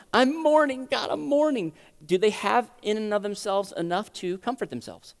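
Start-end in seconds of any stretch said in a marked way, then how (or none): 0.1-1.7 higher-pitched acted lament; emotional mourning. Believer mourning before God, emotional plea. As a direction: cry out to God in acted grief.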